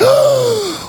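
high sharp gasp; a sudden high intake of breath; mock shocked gasp.

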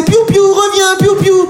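high sing-song call to a dog; high dog owner call. Retold or imitated. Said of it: "Elle lui disait". (voix aiguë qui appelle son chien)

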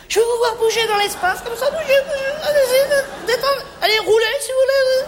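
high, animated coaxing; gushing sales patter. Acted. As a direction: urge the customer on in a high voice. Gushing sales patter urging customer to move.

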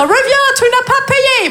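put-on very high, slow English-accented French. Woman calling back her fleeing date, high English accent. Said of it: "parler français avec un accent anglais".

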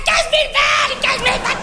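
high, frantic yelled repetition; mock hysterical. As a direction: imitate the boss losing it. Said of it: "sur un mode complètement hystérique".

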